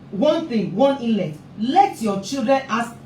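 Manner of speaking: ordinary talk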